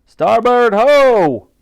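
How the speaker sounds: loud, high, long-drawn naval call; bellowed command. A narrator's reading. The Admiral orders a change of course, bellowing. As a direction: bellow it across the deck.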